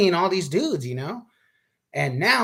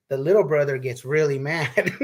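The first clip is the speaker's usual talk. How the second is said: ordinary delivery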